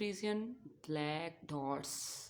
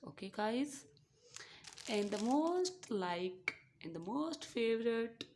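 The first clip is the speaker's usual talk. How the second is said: normal talking voice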